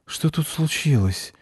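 high, slowing, dazed question; lightly voiced groggy father; sleepy dad. A narrator's reading. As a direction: ask as if just woken by a crash. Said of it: "спросил сонный папа мальчика".

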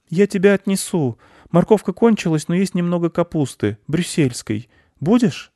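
put-on high-pitched little boy voice; boy looking after a friend; caring boy. A narrator's reading. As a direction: offer help up high like a child. Boy comforts the hare.

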